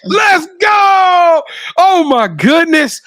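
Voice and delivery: high, near-tearful shout; excited cry of joy; overjoyed. Real, not acted. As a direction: cheer it, almost choking up. Overjoyed host near tears.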